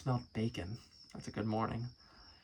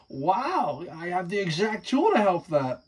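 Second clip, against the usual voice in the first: excited uncle; 5 semitones higher, 8 dB louder than usual. Acted.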